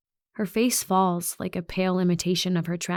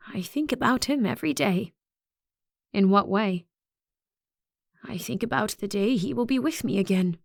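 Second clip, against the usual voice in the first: eerie wistful principal; 3 semitones higher than usual. Acted. Principal musing to a worried student, strangely wistful. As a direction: sound lost in a private hope.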